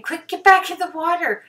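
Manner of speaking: high loud urgent call; mama dolphin voice. Pretend. Mother dolphin urging baby into the water, high and loud. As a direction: call the calf home in a high squeal. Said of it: "the mom is saying".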